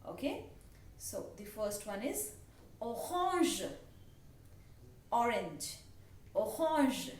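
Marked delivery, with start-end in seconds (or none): 2.8-7.1 orange with a throaty French r and zh at the end. Shown as a demonstration. Teacher modelling orange in French, slow and clear. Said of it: "The pronunciation is entirely different".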